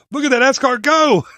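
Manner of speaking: loud, high-pitched punchline shout; onlooker calling out. Acted. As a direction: holler it like an amazed bystander. Joke punchline, bystander calling out.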